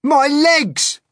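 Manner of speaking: loud, high outraged cry; angry scream. Acted. Paint-covered centipede finds legs stuck, screaming angrily. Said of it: "The centipede screamed with anger".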